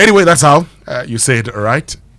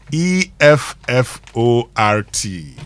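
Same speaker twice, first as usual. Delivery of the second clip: slow, spaced-out letter-by-letter spelling; measured spelling-bee delivery; much slower than usual. Shown as a demonstration. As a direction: spell it slowly, one letter at a time. Spelling out the day's word.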